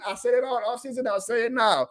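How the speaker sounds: ordinary talk, nothing marked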